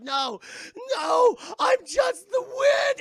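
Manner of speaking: high, loud, slow drawn-out wail; dying scream. Retold or imitated. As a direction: wail it as he blows apart. Wayne Knight dies exploding.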